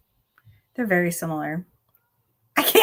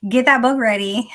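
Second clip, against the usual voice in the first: excited cheer; 4 semitones higher, 6 dB louder than usual. Real, not acted. Cheering friend on.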